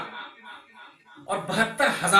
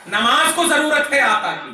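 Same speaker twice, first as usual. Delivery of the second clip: raised, forceful answer; emphatic proclamation; 5 semitones higher, 7 dB louder than usual. Real, not acted. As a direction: declare the answer to the whole crowd. Emphatic answer reversing the question. (ज़ोर देकर एलान)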